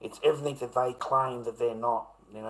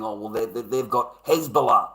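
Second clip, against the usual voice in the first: mocking quote; 3 semitones higher, 9 dB louder than usual.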